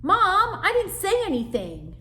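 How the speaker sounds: very high, loud screech; screeching sister. Acted. Sister screeching her innocence at mother. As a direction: protest at the top of her lungs. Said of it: "Zipper Nose screeched".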